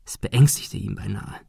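plain reading voice

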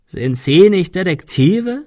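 slow, high, croaked question; raspy, rising villain's question; croaking. A narrator's reading. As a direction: croak it out mockingly. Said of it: "krächzte er".

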